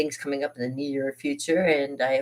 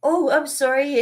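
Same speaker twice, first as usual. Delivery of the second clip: high, louder mock apology; retold child's apology; 11 semitones higher, 5 dB louder than usual. Child to the janitor in a retold story, high mock apology. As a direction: play your startled younger self apologizing. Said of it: "And I went".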